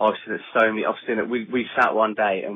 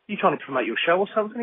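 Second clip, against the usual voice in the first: higher-pitched imitation of a needling friend; mock bickering; 9 semitones higher, faster than usual. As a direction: jab at him in a mock huff. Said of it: "they start bitching about it".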